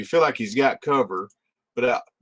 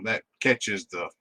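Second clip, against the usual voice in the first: ordinary talk, nothing marked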